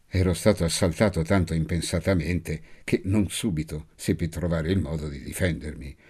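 plain reading voice

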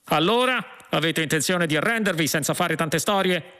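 understated high-pitched policeman's yell. A narrator's reading. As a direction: demand surrender with a mild shout. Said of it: "urlò uno dei poliziotti".

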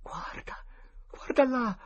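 urgent whisper, climbing in pitch; whisper, pushing harder on the repeat. A narrator's reading. Tom pointing out something in the graveyard, whispering. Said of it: "sussurrò Tom".

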